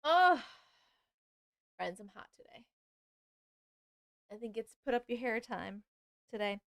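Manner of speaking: usual speaking voice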